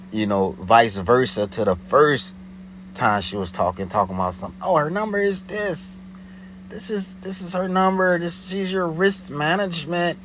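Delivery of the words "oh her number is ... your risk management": higher hurried mock panic; imitating the caller now eager to please; panicky. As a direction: rush the answer, higher. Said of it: "more panicky".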